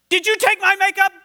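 high, loud accusing snap; snapping sister. Acted. Older sister accuses about stolen makeup, loud snap. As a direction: demand it back, sharp and loud. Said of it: "Brooke snapped".

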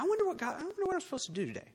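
high, light mock wondering; aimless musing. Imagining a Jesus with no mission, high mock musing. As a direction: muse idly in a high little voice.

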